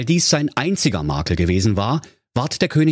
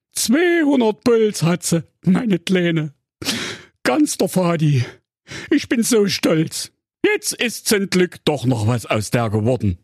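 moved father; 8 semitones higher than usual. Acted. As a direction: gush with tearful pride. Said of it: "mit Tränen der Rührung".

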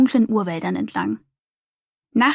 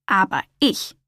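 ordinary delivery, nothing marked

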